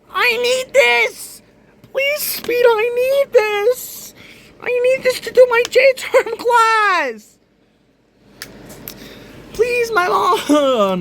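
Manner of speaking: high, slow, fake-crying plea; hammed-up tearful pleading; mock whiny begging. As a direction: beg like a whimpering kid, half joking. Begging over the broken laptop.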